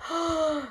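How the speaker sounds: soft, sharp intake of breath; acted storybook gasp; worried gasp. A narrator's reading. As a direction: gasp in sudden alarm. Child feels something wrong.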